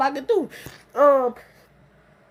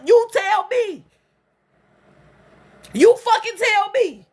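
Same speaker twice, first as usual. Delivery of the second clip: louder, higher, furious challenge; angry demand; 6 semitones higher, 3 dB louder, slower than usual. Real, not acted. Furious at her attacker, demanding he answer.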